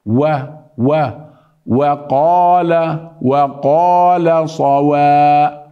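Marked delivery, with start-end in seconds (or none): 1.7-5.7 وقال slow with thin و; واو مرققة; light waw. Shown as a demonstration. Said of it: "وليس صوابا".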